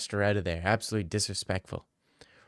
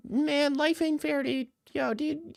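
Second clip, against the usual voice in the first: very high, slow, squeaky falsetto; mock a puny beta male in falsetto; high-pitched wimpy dude voice; whiny beta male; slower than usual. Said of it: "if you're five six beta male".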